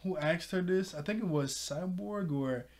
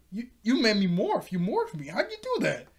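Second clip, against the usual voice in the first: shocked; 6 semitones higher, 4 dB louder than usual. Retold or imitated. Beast Boy forced to morph.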